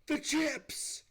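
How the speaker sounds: high, sudden cry of alarm. Retold or imitated. Retelling the moment they smelled smoke, alarmed high cry. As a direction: yelp it as the memory hits. Realizing the chips are burning.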